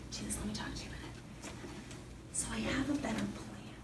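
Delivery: fast, hushed aside; confidential whisper. Retold or imitated. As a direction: pull him close and whisper quickly. Peter taking Jesus aside.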